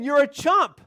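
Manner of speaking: a hint of an insolent parrot; talking parrot. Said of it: "he said it again".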